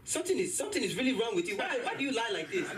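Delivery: high mom scolding. Retold or imitated. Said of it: "She goes".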